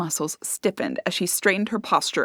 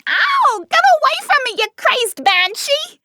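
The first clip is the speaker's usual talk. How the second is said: much higher, louder pained screech; 4 dB louder than usual. A narrator's reading. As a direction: screech as hair is pulled. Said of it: "The woman screeched".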